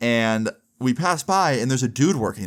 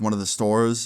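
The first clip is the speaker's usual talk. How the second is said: ordinary delivery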